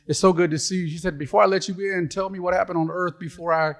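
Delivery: high, excited wife voice; bubbly, high-pitched delight; gushing wife. Acted. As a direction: gush at the husband arriving at heaven's gate. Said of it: "And so she's like".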